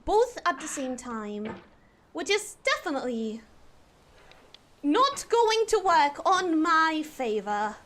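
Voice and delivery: earnest, over-enunciated Queen's English; posh British accent. Shown as a demonstration. Streamer promising viewers she will try, posh British. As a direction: keep up a determined British accent. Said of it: "do a posh British accent".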